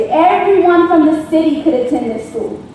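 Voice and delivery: ordinary talk, nothing marked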